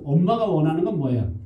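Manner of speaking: ordinary talk, nothing marked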